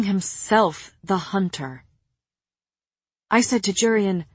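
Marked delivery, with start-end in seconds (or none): none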